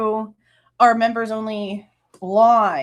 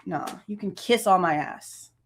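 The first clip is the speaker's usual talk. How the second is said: normal talking voice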